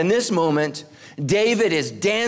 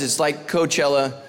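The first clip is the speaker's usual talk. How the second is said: usual speaking voice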